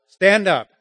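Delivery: "Stand up" loud and high; emphatic loud call to stand up; bold command. Shown as a demonstration. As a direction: proclaim it like a rally cry. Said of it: "three main rules".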